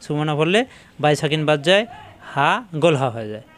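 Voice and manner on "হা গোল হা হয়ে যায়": usual speaking voice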